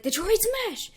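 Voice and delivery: high attack shout; attack yell. Acted. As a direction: call the move out high. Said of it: "He's like".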